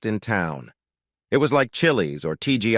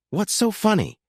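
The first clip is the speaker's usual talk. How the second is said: much higher sharp retort; snapping; 13 semitones higher than usual. Acted. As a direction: snap it back, sharply offended. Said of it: "she snapped back".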